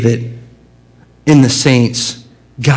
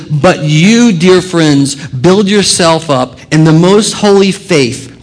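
louder, slow scripture reading; preacher reading Jude aloud; preaching read; 3 semitones higher, 3 dB louder, slower than usual. Acted. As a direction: proclaim the verse. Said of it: "He says".

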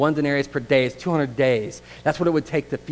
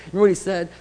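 ordinary delivery

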